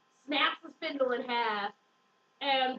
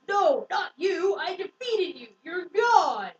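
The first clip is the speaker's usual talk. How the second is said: loud, raised-pitch dramatic defiance; mock defiant cry; 4 semitones higher, 6 dB louder, slower than usual. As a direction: cry it out like a hammed-up heroine. Heroine acted defying the enemy.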